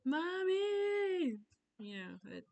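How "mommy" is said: high, loud mock kid shriek; imitated child's scream. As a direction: yell for mom like a demanding child. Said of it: "my child not screaming in".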